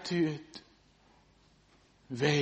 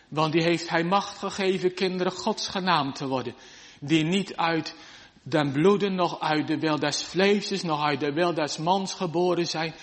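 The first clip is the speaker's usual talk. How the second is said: raised, fast sermon voice; preaching; 4 semitones higher, 4 dB louder than usual. Acted. Preacher urging the congregation, fervent preaching. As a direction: preach it out with fervour.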